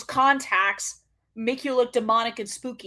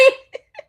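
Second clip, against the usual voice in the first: short high laugh; 14 semitones higher, 9 dB louder than usual. Real, not acted. Laughing with a commenter.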